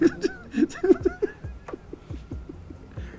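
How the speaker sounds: squeaky falsetto giggle; high falsetto laugh. Real, not acted.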